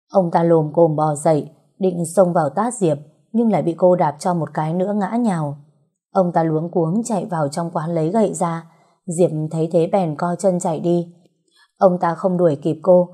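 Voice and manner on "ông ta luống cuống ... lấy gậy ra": usual speaking voice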